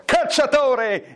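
high, stretched summons; hammed-up angry call; mock furious cry. A narrator's reading. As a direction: call out as the enraged stepmother. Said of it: "La matrigna infuriata gridò".

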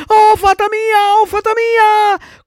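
very high, drawn-out wail; panicked shrieking. A narrator's reading. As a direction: scream for help like a terrified child. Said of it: "cominciò allora a strillare il burattino". (strillo disperato e acutissimo)

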